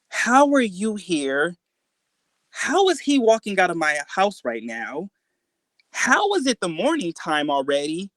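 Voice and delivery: high-pitched, bewildered questioning; baffled. Retold or imitated. Asking mom how she is here, baffled. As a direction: stretch the questions out in disbelief. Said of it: "I'm just completely baffled".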